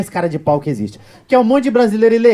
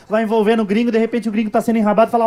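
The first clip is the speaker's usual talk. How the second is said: ordinary talk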